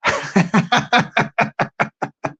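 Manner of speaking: breathy, wheezy giggling fit; laughing. Real, not acted. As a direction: crack up at your own impression. Laughs at own impression.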